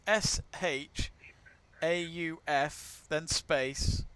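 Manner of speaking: slow, letter by letter; spelling it out. Shown as a demonstration. Host spells the golfer's surname for viewers, slowly. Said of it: "spelling the name".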